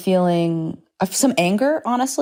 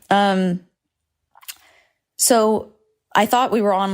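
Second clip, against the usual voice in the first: ordinary delivery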